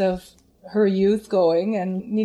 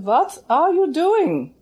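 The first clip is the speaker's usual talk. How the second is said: put-on higher, slow German-accented alarmed question; Lehmann's German accent; 8 semitones higher, 4 dB louder, slower than usual. Teacher stops the student mid-song, German-accented alarm. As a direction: imitate the German teacher cutting in. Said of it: "I loved her accent".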